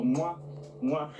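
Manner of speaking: two quick "mwah" kiss sounds; smacked mwah, one for each cheek; imitated air kisses. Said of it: "we don't actually kiss, but we go".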